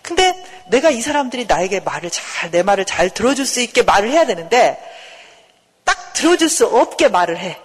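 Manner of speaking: usual speaking voice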